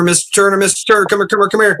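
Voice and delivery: high, insistent imitation of someone calling after him; mock urgent summons. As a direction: hail someone who is walking off, repeatedly. Court people call him back.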